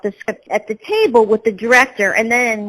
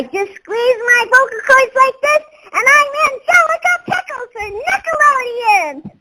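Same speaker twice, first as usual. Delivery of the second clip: put-on much higher, squeezed bratty three-year-old girl voice; Angelica Pickles voice. Shown as a demonstration. Voice actress demonstrating her cartoon brat for an interviewer, high squeezed toddler voice. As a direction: squeeze the throat tight and play a bratty little toddler. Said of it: "what would I sound like as a three-year-old".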